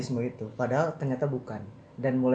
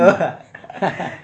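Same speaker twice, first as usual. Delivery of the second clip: laughing; 4 semitones higher, 5 dB louder than usual. Real, not acted. Laughs at the "official" remark.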